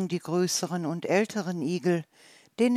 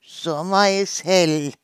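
slow, much louder salamander line; salamander explaining; 9 dB louder, slower than usual. Acted. Salamander answers the little hedgehog, slow and loud. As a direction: declare it slowly and boldly.

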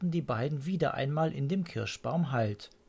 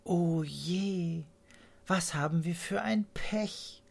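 raised, bird-like lament; dismayed swallow; 6 semitones higher than usual. A narrator's reading. Swallows dismayed at the snow, high little bird voice. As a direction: sigh it out like a small unlucky bird. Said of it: "dachte das Schwalbenpaar".

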